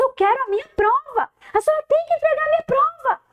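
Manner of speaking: high-pitched childish insistence, acted; demanding student voice. Boy demanding his exam from the teacher, high insistent voice.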